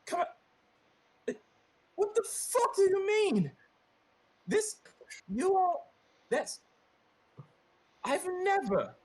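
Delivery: high, furious NPC rant with laughs breaking in; a woman at her wit's end tearing into the party; Melissa blowing up. Acted. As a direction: GM voicing Melissa's shrill outburst at the party. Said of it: "She's like".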